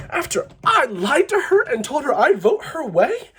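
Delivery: half-laughing fake sob of indignation; hammed-up tearful outrage; mock crying. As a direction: whine it like a wounded victim.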